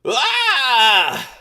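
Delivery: high loud startled yell; Swear impression. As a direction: burst out a sudden high yelp. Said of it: "can I do my swear impression?".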